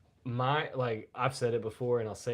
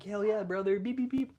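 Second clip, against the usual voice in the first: high mock cheer; 9 semitones higher than usual. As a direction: toss it up high and goofy. Commenters quoted replying.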